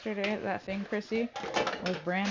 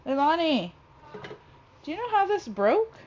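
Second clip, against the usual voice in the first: raised, high-pitched call; louder, higher parental questioning; 9 semitones higher, 7 dB louder than usual. Real, not acted. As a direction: call across the house for an answer. Calling child about broken item.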